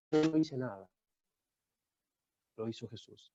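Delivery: voz baja, con largas pausas; déjalo caer quedo y pausado; remate solemne. Real, not acted. (testimonio en tono recogido, muy suave)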